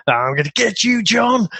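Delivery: a hammed-up maniac villain losing it; unhinged Bennett. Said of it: "he goes from, again, this cold-blooded killer to".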